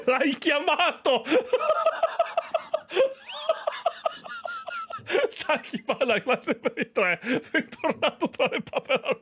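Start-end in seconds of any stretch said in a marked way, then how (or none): 0.1-1.2 laughing through the words. Real, not acted. Laughing at the donation message. 1.3-5.4 a long high burst of laughter. Real, not acted. Big laugh at the message.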